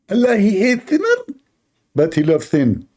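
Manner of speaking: high mocking preacher voice; Zakir Naik impression. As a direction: parody the cleric's pronouncement, pitched high. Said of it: "So Zakir".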